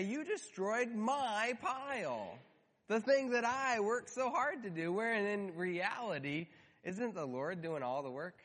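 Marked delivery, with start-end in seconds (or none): 0.0-4.9 high, slow, lightly voiced sulky grumble; whiny complaint. Believers lightly mimicked complaining to God, slow and sulky. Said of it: "And we say".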